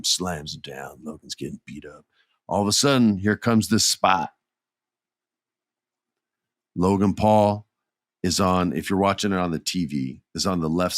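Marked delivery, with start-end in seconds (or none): none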